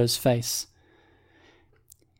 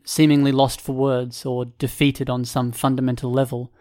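plain reading voice

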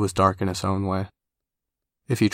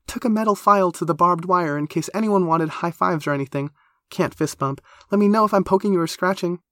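high, eager, gushing character voice; over-keen and high-pitched; 10 semitones higher, 4 dB louder than usual. Acted. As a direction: chatter enthusiastically in a high voice.

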